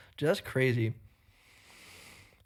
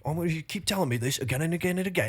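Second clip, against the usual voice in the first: higher, fast mock kid voice; imitating a kid; 4 semitones higher, faster than usual. Joking the kid scolds his dad, high and fast. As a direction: rattle it off like a cocky toddler. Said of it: "He's like".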